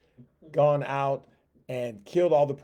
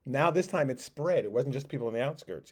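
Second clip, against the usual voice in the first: ordinary talk, nothing marked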